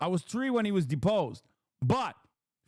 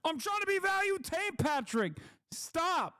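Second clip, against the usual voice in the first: much higher, squeaky mocking whine; 11 semitones higher than usual. Repeating his own complaint in a squeaky mocking whine. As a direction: whine it like a kid being teased.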